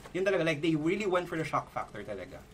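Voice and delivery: ordinary talk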